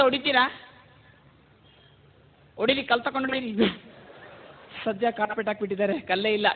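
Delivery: usual speaking voice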